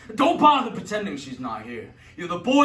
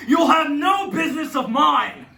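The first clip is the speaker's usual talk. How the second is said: angry shout; 9 semitones higher, 8 dB louder than usual. Acted. Judge storms off.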